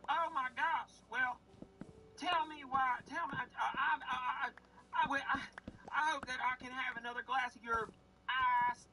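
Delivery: high sweet Southern granny voice; old Southern lady accent. Retold or imitated. Faking a call to Grandma on the phone, high Southern granny voice. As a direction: chirp it up high like a folksy grandma. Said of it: "you're terrible at accents".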